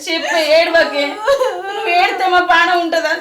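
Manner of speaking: loud, high, anxious begging; distraught mother's urgent questions; frantic pleading. Acted. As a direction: plead with a crying daughter to speak. Mother pleads with crying daughter.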